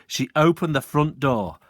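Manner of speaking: usual speaking voice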